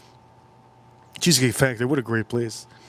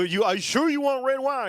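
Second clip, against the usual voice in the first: high mock friend voice; 11 semitones higher than usual.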